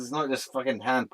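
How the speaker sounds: usual speaking voice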